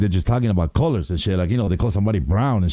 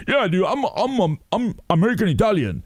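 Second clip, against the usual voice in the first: high put-on dude voice; mock Italian-American; 8 semitones higher than usual. Mocking second-generation guys to his friend, high dude voice. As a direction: parody the guy claiming to be Italian. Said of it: "they're like".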